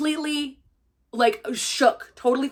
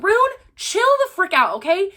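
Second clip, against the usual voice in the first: high, loud, fed-up outburst at Rune; shouting at a character to chill; exasperated yell; 8 semitones higher, 5 dB louder than usual. Retold or imitated. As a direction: yell at him to calm down. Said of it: "I was like".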